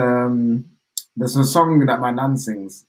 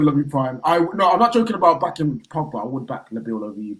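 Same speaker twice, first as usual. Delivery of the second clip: ordinary delivery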